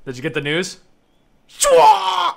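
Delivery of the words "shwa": high, loud squealed yelp; silly attack cry. Pretend. Player voicing a game move, high squealed yelp. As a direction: let out a squeaky action shout.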